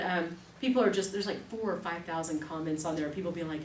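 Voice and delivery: normal talking voice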